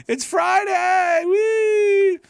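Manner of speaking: high, loud, slow squeal of joy; giddy shout. Real, not acted. Host squealing to viewers about Friday, high and loud.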